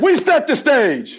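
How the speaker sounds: call and response. Real, not acted. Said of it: "Say it with me".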